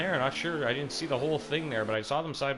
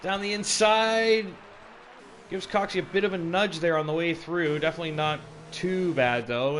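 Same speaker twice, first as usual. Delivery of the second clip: excited play-by-play; 6 semitones higher, 5 dB louder than usual. Real, not acted. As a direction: raise it for the overtake. Overtake called live.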